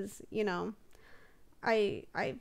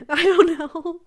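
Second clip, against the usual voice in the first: embarrassed laugh; 8 semitones higher, 13 dB louder, slower than usual. Real, not acted. Embarrassed over cosplay idea.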